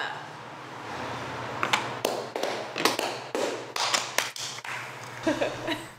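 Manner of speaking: long unvoiced laugh; breathy laughter. Real, not acted. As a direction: laugh freely after the toast. Laughing after the group toast.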